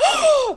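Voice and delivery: a sudden, high startled gasp; sharp gasp. Retold or imitated. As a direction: gasp as if the twist just hit you. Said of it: "I gasped at my desk".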